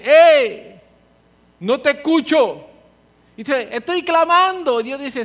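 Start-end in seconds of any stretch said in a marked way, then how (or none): 0.0-2.6 loud high call of Hey, then a drawn-out No te escucho; booming mock divine reply; God shouting back. God's voice acted in sermon. 3.7-4.8 high, loud, slow cry of a pleader; mock desperate plea; pleading believer.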